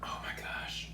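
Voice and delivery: breathless whispered delight; awed whisper. Real, not acted. Tasting mango for the first time, a whispered wow. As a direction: whisper it as the taste hits you.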